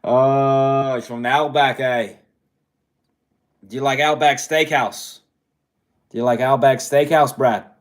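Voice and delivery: loud mock Aussie accent; butchered Australian accent. Host ribbing Brad about Outback Steakhouse, mock Australian accent. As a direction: put on a hammed-up Outback Australian voice. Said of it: "I'm butchering an accent right now".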